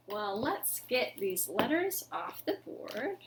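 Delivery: quiet whispered aside. Real, not acted. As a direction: whisper it while tidying up. Storytime aside.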